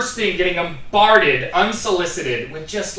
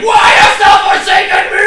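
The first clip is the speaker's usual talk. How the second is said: much higher, louder biblical wail; hammed-up anguished cry; 11 dB louder than usual. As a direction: rip the jersey and cry to heaven. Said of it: "ripping his jersey and going".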